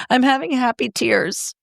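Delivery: high-pitched, imitated announcement to the kids; happy-tears announcement. Mom telling her kids about happy tears, high-pitched retold announcement. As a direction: announce it high, as if happily tearful. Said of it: "Like, I announce it".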